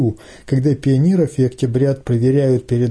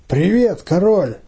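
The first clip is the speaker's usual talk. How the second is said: higher-pitched hearty hello; eager, raised-pitch hail; hailing greeting; 5 semitones higher than usual. A narrator's reading. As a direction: call out a greeting to the gang leader. Boy greets the gang leader.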